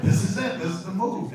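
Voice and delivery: hyped reaction to the drawing; excited. Retold or imitated. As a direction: sell it like a breakthrough.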